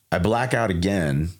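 ordinary talk

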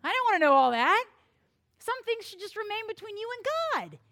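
loud, fast brush-off, rising high at the end; blunt refusal. Retold or imitated. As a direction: cut her off firmly, then go up and away. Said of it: "And I would go".

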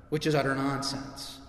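usual speaking voice

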